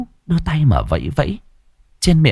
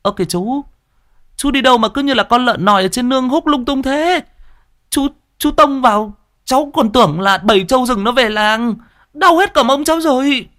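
put-on boy crying out. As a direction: yelp like a knocked-over boy. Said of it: "thì kêu lên".

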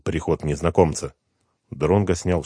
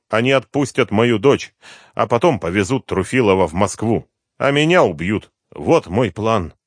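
lightly voiced tense plan; Вейдеманис's tense voice; 7 semitones higher, 7 dB louder than usual. A narrator's reading. Father tells the detective his plan, tense. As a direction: explain the plan fast and grim.

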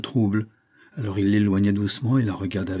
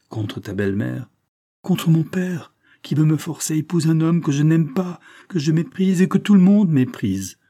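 higher and louder, building anger; rising indignation; 6 semitones higher, 4 dB louder than usual. Acted. Girl telling grandfather of a forced marriage, rising angry outburst. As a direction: spit out her contempt, growing louder.

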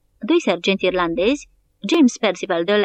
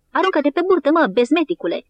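shouted warning; 7 semitones higher than usual. A narrator's reading. Soldier yells at the piper.

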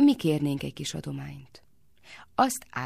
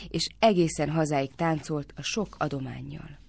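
usual speaking voice

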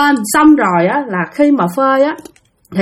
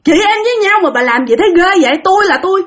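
high, heated yelling back; angry retort; 6 semitones higher than usual. Retold or imitated. Younger sister yelling back at her older sister. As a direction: hit back in a rage. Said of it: "Bạn tức lắm, bạn nóng lên".

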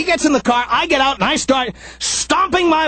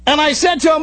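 ordinary delivery

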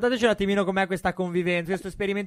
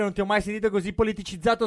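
ordinary talk

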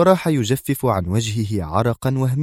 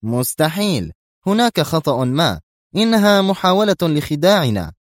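exclaiming, building; 8 semitones higher than usual. A narrator's reading. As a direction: build from shock to outrage. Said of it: "فهتف".

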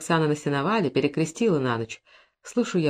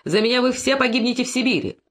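mild shout; 6 semitones higher than usual. A narrator's reading. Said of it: "кричит опять пан".

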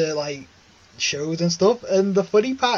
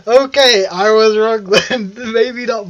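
laughing; 5 semitones higher, 9 dB louder, slower than usual. Real, not acted. Mock concession after being corrected.

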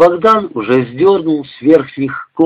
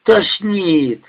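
queasy moan; 5 semitones higher than usual. Acted. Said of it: "простонала Люсенька".

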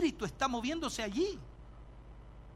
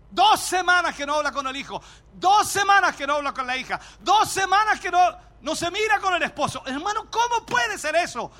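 loud, high preaching build; rising pulpit fervor; fiery preaching; 6 semitones higher, 7 dB louder than usual. Acted. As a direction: preach it louder with every line. Preaching at full force.

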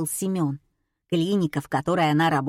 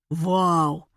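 slow, louder mock delight; 3 semitones higher, 4 dB louder, much slower than usual. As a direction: savour the win. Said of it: "восхитился Николай". (восторженно)

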